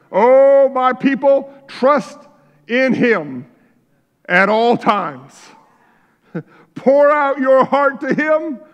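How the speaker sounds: loud, high, slow exhortation; full-force preaching. Acted. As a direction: cry out to the flock.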